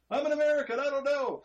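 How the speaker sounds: high, playful dumb-tourist voice; mock clueless American. Joking about themselves as carefree American drivers, mock clueless voice.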